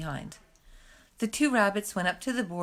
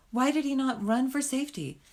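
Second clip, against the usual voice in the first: plain reading voice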